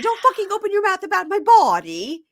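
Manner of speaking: high, loud shrieked accusation; mimicking her screaming at him in front of everyone; hysterical yelling. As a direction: screech it, mocking her hysteria. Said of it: "hysterically yelling".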